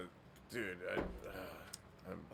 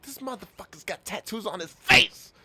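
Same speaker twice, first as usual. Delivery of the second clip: louder, higher hype for a tattooed brute; hype-man voice; 4 semitones higher, 8 dB louder than usual. Pretend. Manager hyping his monster wrestler, loud and higher. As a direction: sell him like a ringside hype man.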